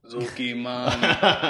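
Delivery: laughing. Real, not acted. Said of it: "You sound like someone from Sesame Street".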